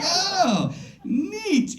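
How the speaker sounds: very high, fake-delighted coo; mock-impressed high voice. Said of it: "You know, like".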